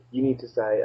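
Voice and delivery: normal talking voice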